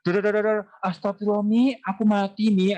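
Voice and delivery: put-on a kid yelling gunfire noises, then dying in the game; child gaming excitedly. Child at a game calls to mom, excited and loud. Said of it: "anaknya ketika main game".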